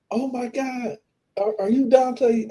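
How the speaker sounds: high, breathless fan squeal; starstruck fan. Retold or imitated. Fan at the gas station recognizing him, high. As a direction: mimic a starstruck lady. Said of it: "this lady was like".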